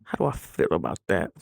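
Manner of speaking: low, dubious pondering voice; mock skeptical low mutter; skeptical musing. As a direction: drop low and weigh it doubtfully. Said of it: "I'm like".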